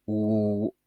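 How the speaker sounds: long, held "ou"; long vowel. Shown as a demonstration. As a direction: hold "ou" as one steady long vowel. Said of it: "une voyelle longue qui correspond au son". (« ou » long et tenu)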